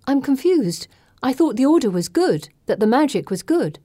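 confused. A narrator's reading. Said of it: "I interrupt".

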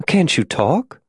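a hint of Molly's character, rising question; lightly voiced woman.

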